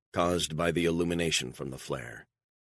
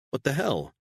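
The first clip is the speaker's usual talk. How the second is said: much higher startled cry; shocked outburst; 10 semitones higher than usual. Acted. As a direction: blurt it out in alarm. Plan shelved, Beatrice reacts.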